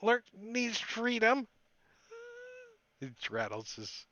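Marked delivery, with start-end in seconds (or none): 0.0-1.5 the goblin begging for food too. Acted. Goblin speaks again.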